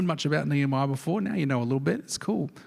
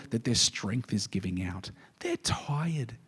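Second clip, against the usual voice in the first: drained and barely voiced; tired whisper; 3 semitones lower, 6 dB quieter than usual. Preacher whispering the people's weariness. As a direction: breathe it out in a whisper.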